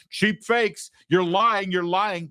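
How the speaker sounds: high, mocking sneer. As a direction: mimic the denial scornfully. Mocking the cheap fakes denial.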